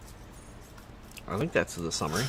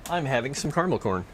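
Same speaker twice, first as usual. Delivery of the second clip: usual speaking voice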